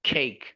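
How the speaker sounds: "खेक" with an airy first consonant; aspirated K. Shown as a demonstration. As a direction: puff out the start of "खेक". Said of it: "केक नहीं". (हवा के साथ "खेक")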